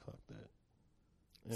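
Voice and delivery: breathy whisper of "fuck that"; whispered, brushing it off; whispered dismissal. Retold or imitated. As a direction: hiss it under your breath. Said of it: "And I'm like".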